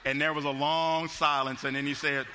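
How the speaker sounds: normal talking voice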